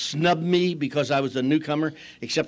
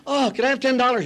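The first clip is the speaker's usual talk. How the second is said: higher-pitched mock daughter asking Dad; light girlish pleading, pitched up; wheedling daughter; 7 semitones higher, faster than usual. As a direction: imitate a girl hitting up her father. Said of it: "My daughters used to get money".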